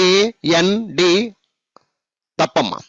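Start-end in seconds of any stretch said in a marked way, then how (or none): 0.1-1.1 AND slow, a gap between letters; divided letters. Shown as a demonstration. Teacher showing pupils the mistake, AND slowly divided letter by letter. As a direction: break AND into separate drawn-out letters. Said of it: "అలా పలకకూడదు".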